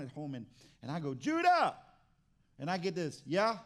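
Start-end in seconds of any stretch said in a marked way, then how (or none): 1.1-1.8 loud high shout of a name; calling out. Retold or imitated. Dad calling his son Judah from home, loud high call. 3.2-3.6 offhand yeah. Retold or imitated. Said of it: "did you just yeah me?".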